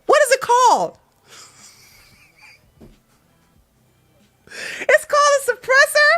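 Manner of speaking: high, loud, incredulous questioning; stunned disbelief. Real, not acted. Streamer realizing the wrong word was used, stunned disbelief. Shocked at learning the right word.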